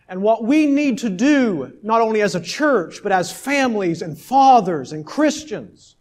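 high, loud, slow climax; preaching at full force. Acted. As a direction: build and drive it to the congregation. Climactic exhortation to church.

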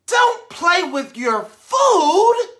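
high, loud, very slow scolding; mom scolding. Acted. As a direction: scold a child at dinner. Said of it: "mom's line in story".

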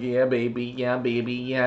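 low, soft idle chant; lazy, easygoing self-imitation; laid-back chilling. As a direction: murmur it like you're relaxing. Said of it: "I was just chilling like".

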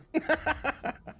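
short high-pitched giggle. Real, not acted. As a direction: giggle at your own joke. Laughs at own joke.

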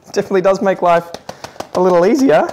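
hammed-up admiration; sarcastic praise. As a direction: lay on the praise with tongue in cheek. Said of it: "Note the sarcasm".

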